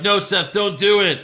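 mock pleading. Host acts out people begging Seth to stop, mock pleading. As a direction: beg him in hammed-up alarm.

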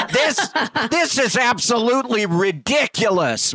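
indignant high mock whine; angry passenger.

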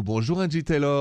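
mild put-on French accent; a touch of French accent on the welcome. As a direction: welcome them back with a light French accent. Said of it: "My accent is French".

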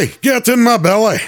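high-pitched, hammed-up Scottish cry; Fat Bastard. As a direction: call it out high in a cartoon Scottish accent. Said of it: "do a couple lines of fat bastard".

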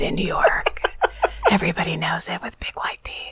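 hushed, conspiratorial secret-society whisper. Pretend. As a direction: whisper it like insider lore. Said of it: "you whisper very quietly".

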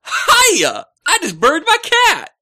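very high, loud, hammed-up greeting; exaggerated comic impersonation. Guest asked to impersonate the email writer, exaggerated voice. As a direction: belt it out like a manic caricature. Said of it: "you can impersonate him better".

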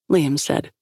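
ordinary delivery

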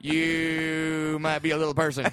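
slow drawn-out punchline, long "you"; Jeff Foxworthy. Acted. Guest finishing his improvised joke, slow impression. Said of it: "But in Jeff Foxworthy".

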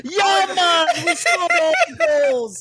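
high, hammed-up Jamaican impression; laughing, high-pitched mock Jamaican; mock Jamaican accent. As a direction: tease with a big fake Jamaican accent. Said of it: "Why are you Jamaican?".

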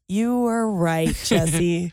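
drawn-out mock concession; slow, on request. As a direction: stretch each word out slowly, playing along. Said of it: "Can you say that slow".

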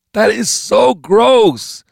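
very high, drawn-out revulsion; slow, loud squeal of disgust. Acted. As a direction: stretch it out, thoroughly grossed out. Gunman disgusted by dead body.

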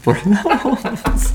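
slow, high laughing toast. Real, not acted.

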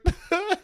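high, helpless laugh-sob; laugh-crying burst. Real, not acted. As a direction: lose it completely. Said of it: "I was crying".

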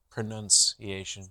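pronunciation slowly, with "nun" in the middle; slow, careful pro-nun-ciation; pronunciation sounded out. Shown as a demonstration. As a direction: test out pronunciation, low, soft and slow. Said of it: "I think pronunciation".

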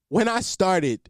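slow words squeezed through a real laugh; giggling slowly as you start the story. Real, not acted.

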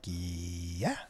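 put-on nervous kid agreeing; meek agreement. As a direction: mumble it sheepishly. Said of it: "I'm like".

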